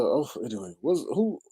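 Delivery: ordinary talk, nothing marked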